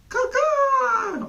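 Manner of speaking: high crowing caw; rooster crow. A narrator's reading. Old cock crowing at dusk, loud and high. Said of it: "crowed loudly".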